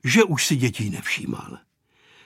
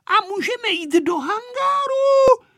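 put-on very high, loud girl's question; child shouting; 5 dB louder than usual. A narrator's reading. Aneška asking grandpa about the hangar, shouting. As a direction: squeal the request out loudly. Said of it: "křičela Aneška".

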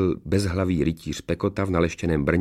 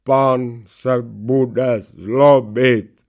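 very slow, loud, higher droning voice; headless knight protesting; 4 semitones higher, 7 dB louder, much slower than usual. A narrator's reading. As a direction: protest in long drawn-out syllables. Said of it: "marně protestovala hlava oklamaného rytíře". (táhlý protest rytířovy hlavy)

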